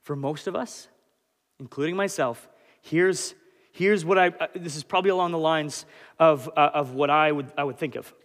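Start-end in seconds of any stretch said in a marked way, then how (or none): none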